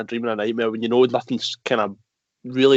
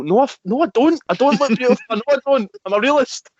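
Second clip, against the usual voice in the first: high-pitched, indignant refusal; flustered, higher-pitched denial; insistent protest; 9 semitones higher, 3 dB louder than usual. Acted. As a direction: protest the compliment, voice pitched right up. Acting out protest against compliments.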